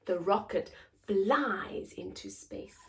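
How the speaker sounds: ordinary delivery, nothing marked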